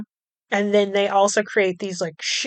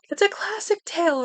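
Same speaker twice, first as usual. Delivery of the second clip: storyteller voice; 8 semitones higher, 5 dB quieter than usual. Pretend. Mock-grand storybook phrasing.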